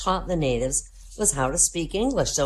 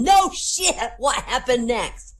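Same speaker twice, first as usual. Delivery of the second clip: eager exclaim; 8 semitones higher, 4 dB louder, slower than usual. Acted. Priest exclaims in confessional.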